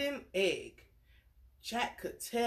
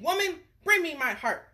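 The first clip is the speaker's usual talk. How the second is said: a higher, louder demanding giant; frustrated giant; 8 semitones higher, 5 dB louder than usual. Acted. Frustrated giant calls to his wife, demanding. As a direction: bark the order at his wife like a grumpy giant. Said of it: "he said".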